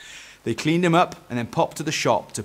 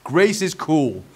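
raised, loud verdict; declaring; 5 semitones higher, 6 dB louder, slower than usual. A narrator's reading. Eric gives Dad his verdict, declaring. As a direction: declare it with conviction. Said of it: "declared Eric".

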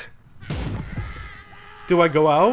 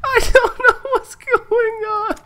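crying panic; 12 semitones higher than usual. Real, not acted. Gamer wailing as people keep dying. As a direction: wail helplessly at the chaos.

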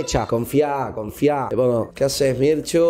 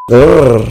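playful lion growl; a loud mock growl like a lioness; 4 semitones lower, 13 dB louder than usual. Teasing a partner with a loud mock growl.

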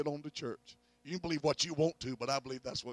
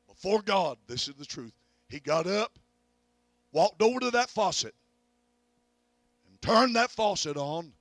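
rising preacher climax; 3 semitones higher, 8 dB louder than usual. Real, not acted. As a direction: build the miracle to its peak.

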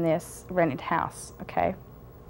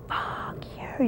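voiceless hissed curse, under the breath; whispered curse; 6 dB quieter than usual. Retold or imitated. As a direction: spit it out in a bare whisper. Retold fight with husband.

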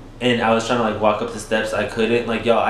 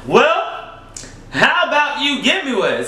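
high, cheeky comeback; talking back to her partner; sassy retort; 12 semitones higher than usual. Retold or imitated. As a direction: fire back a sarcastic question.

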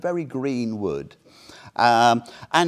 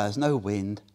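ordinary talk, nothing marked

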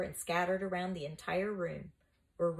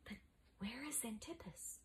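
hushed, anxious, higher voice; anxious question; 6 semitones higher, 16 dB quieter than usual. A narrator's reading. Flavius, ready to flee, asks anxiously. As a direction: ask it softly and anxiously. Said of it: "asked anxiously".

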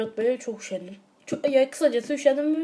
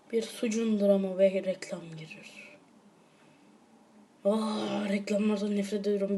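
normal talking voice

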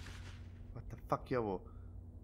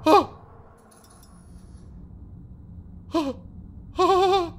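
frightened shriek; 12 semitones higher, 5 dB louder, much slower than usual. Real, not acted. Horror game jump scare.

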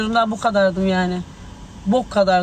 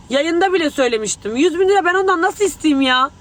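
indignant shout; 6 semitones higher than usual. Real, not acted. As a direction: shout the denial at the accusation. Outraged denial of the claim. (öfkeyle bağırarak inkâr)

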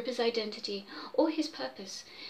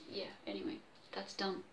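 much softer self-dismissal; muttered aside; 4 semitones lower, 17 dB quieter than usual. Real, not acted. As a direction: mumble it to yourself.

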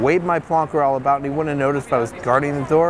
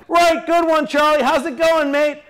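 high-pitched acted shout; yelling back; 14 semitones higher, 4 dB louder, slower than usual. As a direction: holler it cheerily across a room. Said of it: "Ringo ... yelled back".

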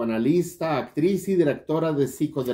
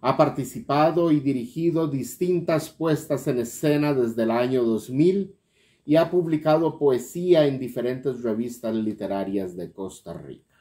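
ordinary delivery

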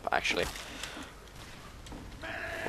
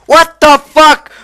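high, loud, slow stunned shout; shocked yell; 16 dB louder, slower than usual. Real, not acted. Gamer yells in shock at a sudden death, high and drawn out. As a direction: yell it in total disbelief.

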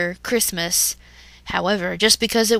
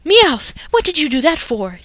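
loud, high annoyed yell; villain scolding the cat, yelling; 7 semitones higher, 6 dB louder than usual. Acted. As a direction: scold her partner sharply. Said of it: "Jesse yelled".

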